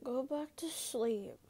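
slow, drowsy murmured order; sleepy boyfriend. Acted. Sleeping boyfriend answers his mate, drowsily. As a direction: drag it out like someone half asleep. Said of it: "at his sleeping boyfriend beside him".

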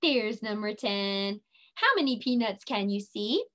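normal talking voice

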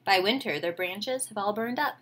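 ordinary talk, nothing marked

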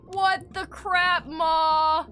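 put-on whining kid. Kid telling Ma he's high again, slow whine. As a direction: stretch it out like a dopey kid.

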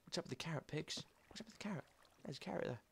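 soft, secretive asides to the animals; hushed to the pigs. Real, not acted. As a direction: murmur to the pigs as if not to scare them. Talking softly to the pigs.

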